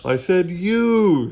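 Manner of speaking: "you" long, high and loud; slow, loud emphasis on "you"; stress on you. Acted. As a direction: stretch out "you" to correct the mishearing. Frank corrects misheard word.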